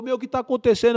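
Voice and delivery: urgent re-enacted outburst; retold alarmed demand. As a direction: act out a heated question mid-shootout. Retold confrontation during shootout.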